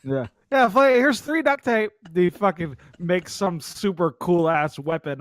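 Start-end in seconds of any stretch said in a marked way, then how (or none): 0.5-1.9 high mocking voice of a friend; a higher, teasing take on Flint; mock buddy voice; imitating Flint.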